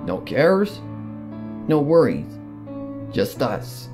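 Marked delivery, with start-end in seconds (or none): none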